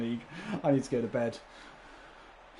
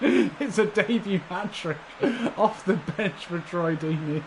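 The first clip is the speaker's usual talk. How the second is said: slow, higher, louder, laughing with delight; 6 semitones higher, 5 dB louder than usual. Real, not acted. Celebrating the striker's hat-trick, laughing with delight. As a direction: savour it through laughter.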